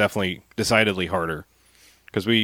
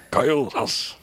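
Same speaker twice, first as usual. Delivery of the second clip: fake Japanese announcer; 7 semitones higher, slower than usual. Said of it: "their fake Japanese announcer voice".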